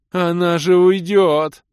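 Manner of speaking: high, loud, drawn-out wail; Док, yelling. A narrator's reading. Док protests as the demoness leaves, yelling. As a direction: yell it in dismay. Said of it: "заорал Док".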